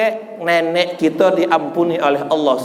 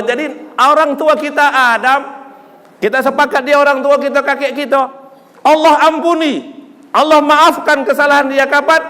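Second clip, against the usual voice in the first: high, emphatic preacher's delivery; impassioned preaching; 7 semitones higher, 4 dB louder than usual. Real, not acted. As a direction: preach it out, pitch held up. Preaches with rising force. (berkhotbah lantang dan berapi-api)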